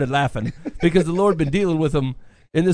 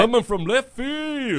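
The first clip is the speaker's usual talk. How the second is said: slow drawn-out hype call, higher; hype man; 8 semitones higher, slower than usual. Pretend. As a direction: pump up the crowd behind the main guy. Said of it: "I will be Ben's hype man".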